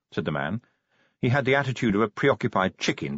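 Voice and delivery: ordinary delivery, nothing marked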